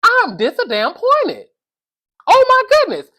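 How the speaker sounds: drawn-out, high-pitched dismay; exasperated disappointment. Acted. Mock-outraged disappointment in Karen.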